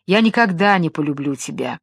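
ordinary delivery, nothing marked

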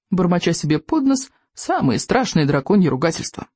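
plain reading voice

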